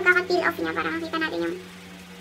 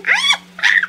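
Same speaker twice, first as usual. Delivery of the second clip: sharp high cries of pain; pained cry; 7 dB louder than usual. Real, not acted. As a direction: cry out as it stings. Pain as mask pulls.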